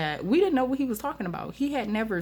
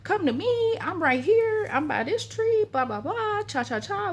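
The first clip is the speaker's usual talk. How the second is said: much higher voices calling out from the fog; friends calling out; 14 semitones higher than usual. Retold or imitated. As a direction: imitate friends shouting to find each other, pitched way up. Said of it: "because I can hear them saying".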